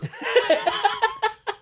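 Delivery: shrieking high laugh; big high cackle. Real, not acted. Cackling at the Jacques joke. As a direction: burst into a squealing cackle.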